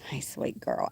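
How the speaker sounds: soft whispered hello. Real, not acted. Farmer whispering sweetly to her pet in her lap. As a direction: hush it, just for the animal. Hushed greeting to her animal.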